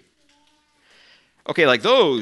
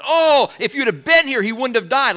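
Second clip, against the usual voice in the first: grieving plea; 13 semitones higher, 6 dB louder than usual. Retold or imitated. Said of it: "Martha says".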